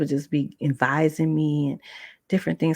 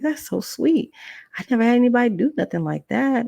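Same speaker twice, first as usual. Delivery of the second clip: high, gushing retold delight; touched delight; 7 semitones higher than usual. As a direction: squeal it out, moved. Touched by teacher's care.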